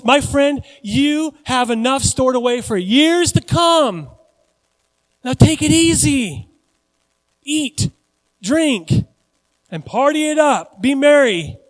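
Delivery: high, loud, slow hammed-up gloating; self-congratulating rich fool. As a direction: grandly tell yourself to kick back and party. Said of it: "who talks like this to himself?".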